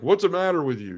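a hint of a puzzled sister knocking on the window; concerned nun.

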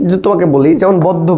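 ordinary talk, nothing marked

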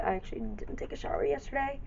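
normal talking voice

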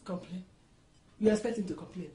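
low, soft, disdainful questioning; quiet disbelief. Acted. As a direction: echo it in low-voiced disbelief. Mother-in-law disdains the reply.

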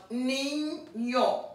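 "niño" drawn out slowly as nin, then yo; split into syllables. Shown as a demonstration. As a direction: break "niño" after the first n sound, slowly. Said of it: "will go with the last syllable".

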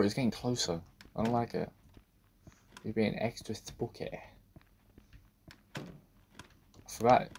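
ordinary delivery

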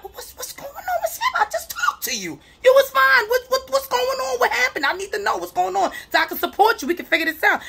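voicing her therapist self. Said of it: "And I'm like".

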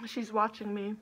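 chewing while talking; muffled behind hand; mouth full. Real, not acted. Seen 1: Bites roll at -9, hand covers chewing mouth while speaking.

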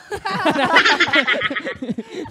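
big burst of laughter; loud laugh. Real, not acted. Laughs at friend's thanks.

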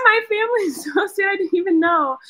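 high, teary words broken by a laugh; laughing through real tears; laughing and crying over a family gift. Real, not acted. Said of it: "I'm gonna cry".

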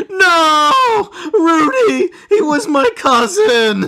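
high, loud wail of grief; anguished cry. Acted. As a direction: cry out in hammed-up anguish, drawing out the words. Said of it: "Tasty Pete cries out".

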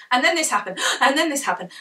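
hurried, gasping for air between clauses; mock the out-of-breath narrator who rushes; rushed breathless storytelling. Shown as a demonstration. Demoing to audiobook newbies what rushing sounds like, breathless and hurried. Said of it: "You don't have to be".

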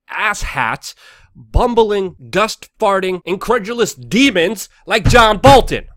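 loud, high outburst of angry insults; heated. Real, not acted. Commentator ranting at a war hawk adviser, heated. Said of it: "a little heated here".

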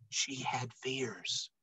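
whisper, slow and hushed; hushed storyteller whisper. A narrator's reading. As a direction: breathe the fear in a slow whisper. Narrating her fears.

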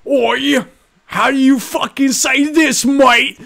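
high, drawn-out British family heckle; exaggerated British accent, pitched right up; mock British accent. Friend's family roasting his speech, mock British accent. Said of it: "the family will be like".

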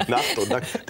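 high, laughing through words. Real, not acted. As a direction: giggle the words out. Laughing at night/naked pun.

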